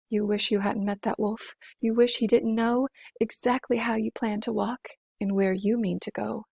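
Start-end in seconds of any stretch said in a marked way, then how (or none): none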